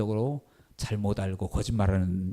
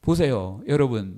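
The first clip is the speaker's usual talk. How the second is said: usual speaking voice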